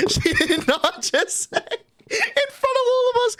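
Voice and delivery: high, squealing crying-laughter; incredulous laughing. Real, not acted. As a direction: laugh so hard the words climb high. Reliving laughing fit at her remark.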